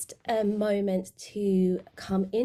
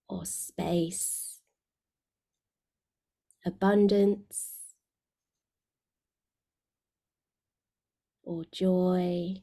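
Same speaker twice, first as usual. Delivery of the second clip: guided meditation. Real, not acted. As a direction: guide them gently inward, letting phrase endings sink away.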